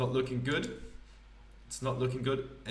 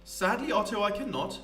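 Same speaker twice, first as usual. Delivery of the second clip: higher, grand theatrical poet voice; pompous poet; 5 semitones higher than usual. Acted. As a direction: play a self-important wandering poet. Poet laments poverty.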